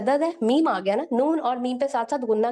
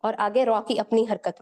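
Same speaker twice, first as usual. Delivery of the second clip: ordinary talk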